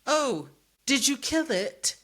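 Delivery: high, slow and airy, faking innocence; mock casual question. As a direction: ask it sweetly, way up high and drawn out. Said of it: "And she's just like".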